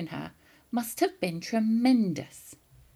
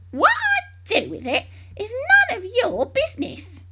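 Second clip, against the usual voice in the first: put-on much higher, louder old crone; louder witchy old woman; crabby grandma; 6 dB louder than usual. A narrator's reading. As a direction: cackle it like a witchy hag. Grandma snaps at George.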